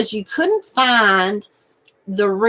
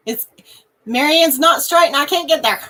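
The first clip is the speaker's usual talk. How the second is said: ordinary delivery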